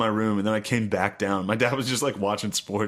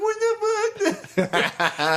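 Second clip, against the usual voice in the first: very high, drawn-out laughing squeal; stunned squeal; much slower than usual. Retold or imitated. Son punched by his dad, squealing in shock. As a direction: stretch it high and slow in shock.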